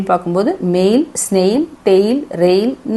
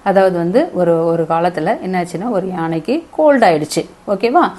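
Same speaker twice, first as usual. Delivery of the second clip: usual speaking voice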